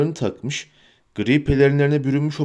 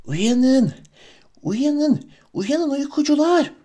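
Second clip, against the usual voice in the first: high, hissing creature whisper; urgent high wheedling creature; Gollum whisper; 11 semitones higher, much slower than usual. Acted. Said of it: "diye fısıldadı".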